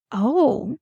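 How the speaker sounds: mock gasp of realization; a drawn, rising-then-falling "oh" of revelation; imitated gasp. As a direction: imitate a staff finally getting it. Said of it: "the most rewarding gasp".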